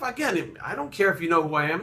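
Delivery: normal talking voice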